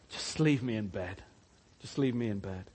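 low, sleepy groan of a lazy man; drowsy sluggard. Acted. Preacher voicing the sluggard who won't leave bed, low and sleepy. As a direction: mumble like you never want to get up.

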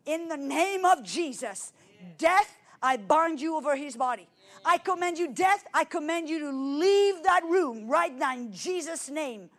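fervent loud authoritative prayer, near tears; commanding prayer. Retold or imitated. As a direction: command death to leave with full force. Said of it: "I heard myself pray".

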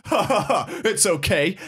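high, put-on judge's chuckle; mock indulgent laugh. Judge excusing the late lawyer, mock laughing. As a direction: fake a hearty laugh and excuse it.

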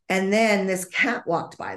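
ordinary delivery

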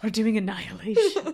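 mock sinister. Shown as a demonstration. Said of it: "It sounds so sinister".

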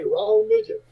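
high squeaky disclaimer; panicked little guy. Retold or imitated. To the big guy asking for money, a high squeaky denial. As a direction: squeak it high, dodging the blame. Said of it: "We still say it to this day".

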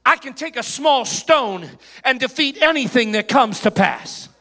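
fiery sermon delivery; preaching. Acted. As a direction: preach it loud and strong. Preaching at full force.

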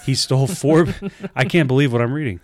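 loud, laughing disbelief while reading; can't believe what you're reading; shocked disbelief. Real, not acted. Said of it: "He's so shocked".

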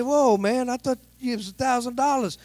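high indignant protest; a sassy, high complaint. Retold or imitated. Preacher protesting to the officer, high and sassy. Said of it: "I'm getting kinda sassy".

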